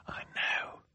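hushed, voiceless whisper; soft whispered reply. A narrator's reading. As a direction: whisper it secretly. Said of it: "Klaus replied in a whisper".

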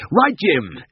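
high, triumphant shout-out; happy call. A narrator's reading. Tristan holds the caught cat high, calling happily. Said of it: "he called happily".

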